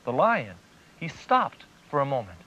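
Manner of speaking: ordinary delivery, nothing marked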